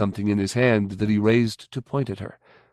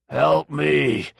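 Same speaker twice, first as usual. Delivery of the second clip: eerie chorus; 6 semitones higher than usual. A narrator's reading. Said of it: "all the voices chorused".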